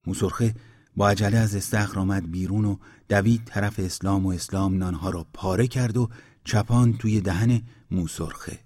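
ordinary delivery

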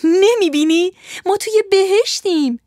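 high, loud cry of delight; joyful shout. Acted. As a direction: shout it out, thrilled. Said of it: "ماریا با خوشحالی فریاد زد". (فریاد از سر خوشحالی)

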